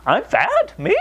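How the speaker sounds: high, indignant mock voice of a clueless fat person. Mocking a fat person who thinks he's skinny, pitched high. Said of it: "that's just like".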